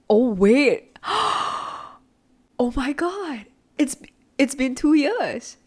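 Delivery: high, gasping shock at the date; sudden realization. Real, not acted. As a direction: gasp as it dawns on her, slow disbelief. Realizes two years have passed.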